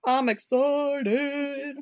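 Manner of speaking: very slow, stretched-out glee; drawn-out excitement. Real, not acted. Host looking forward to the investigation, drawn-out excitement. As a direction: stretch it out in singsong anticipation.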